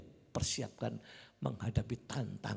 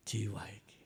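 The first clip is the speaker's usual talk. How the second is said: whisper; 5 semitones lower, 3 dB quieter than usual. Retold or imitated. Inner thought revealed.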